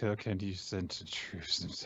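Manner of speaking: slow, worn-out plea; mock exhaustion. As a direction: ask as if utterly spent. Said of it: "they're all very tired".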